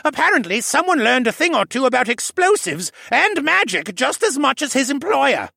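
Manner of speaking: loud, high, scornful rant; exasperated bark. A narrator's reading. Jenner complaining to Alex about Tovish, barking. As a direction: keep barking, biting with sarcasm. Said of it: "Jenner barked".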